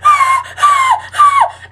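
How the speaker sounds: high laughing screams of "ah"; mock pained screams. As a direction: imitate his yelping pain while laughing. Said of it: "I was like".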